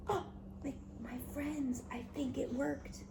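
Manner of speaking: hushed soft whisper. Real, not acted. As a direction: whisper so she won't wake. Whispering, child fell asleep.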